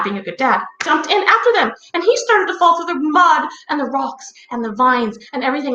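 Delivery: ordinary delivery, nothing marked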